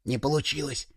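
soft, hushed disappointed remark; whispered. A narrator's reading. A child to friends under the table, whispering. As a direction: whisper it under your breath. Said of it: "шепнул кто-то из ребят".

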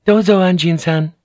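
high-pitched, questioning woman's voice; polite housekeeper. A narrator's reading. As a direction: lift the voice high, politely prompting. Housekeeper prompts him in story.

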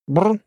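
rolling animal brrr; animal growl. Acted. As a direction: growl like a bear. Panda growls appearing.

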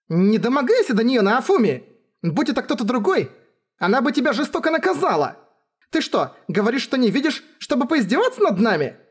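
high-pitched, indignant rant; flustered high scolding; scolding princess. Acted. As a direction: tell someone off in a high, affronted voice.

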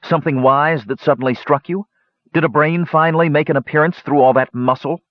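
high-pitched mocking questions; scoffing. A narrator's reading. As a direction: taunt a brother with scornful questions. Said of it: "Palin scoffed".

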